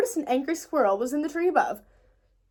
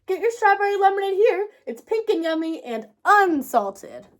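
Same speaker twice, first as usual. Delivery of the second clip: calling out; 7 semitones higher, 5 dB louder than usual. A narrator's reading. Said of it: "calling out for a strawberry lemonade".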